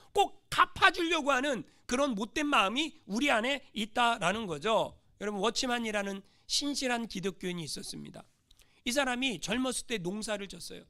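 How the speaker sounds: usual speaking voice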